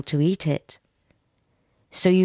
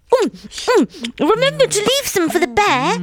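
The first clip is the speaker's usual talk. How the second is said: high, loud wife with a full mouth; wife talking while stuffing herself; mouth crammed full; 14 semitones higher, 5 dB louder than usual. A narrator's reading. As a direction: squeal it out through a mouthful of food. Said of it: "speaking with her mouth crammed full".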